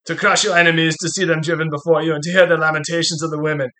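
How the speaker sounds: put-on loud, fast Austrian-accented Conan delivery. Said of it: "you gotta, gotta do the accent".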